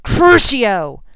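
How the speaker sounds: loud, high hollered curse; villain's spell yelled out hard; cold holler. A narrator's reading. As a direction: holler the curse coldly and forcefully. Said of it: "Malfoy's cold voice hollered".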